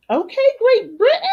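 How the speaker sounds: greeting a British viewer, high and loud; playful greeting. Real, not acted. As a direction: hail them like an excited host.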